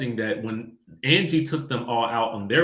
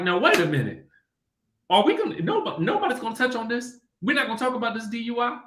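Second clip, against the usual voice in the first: incredulous; 10 semitones higher, faster than usual. Retold or imitated. Host retells her own disbelief.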